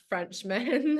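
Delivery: light mock French accent. Friend recalling a night out with besties, light French accent. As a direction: say it with a playful touch of French. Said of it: "giving everyone an accent".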